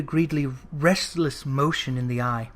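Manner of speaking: usual speaking voice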